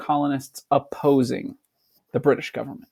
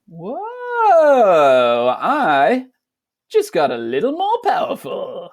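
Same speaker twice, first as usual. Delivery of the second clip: much higher, louder gasp of wonder; mock amazed kid; 6 semitones higher, 5 dB louder, slower than usual. Student imagining winning against parents, much higher and louder in amazement. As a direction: play a kid stunned by newfound power.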